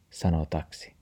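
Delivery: plain reading voice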